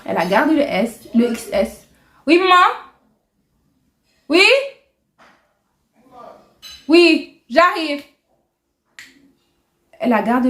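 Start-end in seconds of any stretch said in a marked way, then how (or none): 2.2-8.0 calling out. Real, not acted. Answering mum from another room.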